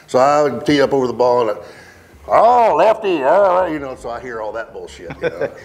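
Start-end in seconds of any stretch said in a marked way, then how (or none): 2.3-3.7 higher, louder teasing call at a lefty; mock heckle. Mimicking playing partners heckling him on the tee, higher and louder. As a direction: imitate the guys ribbing him, higher and louder.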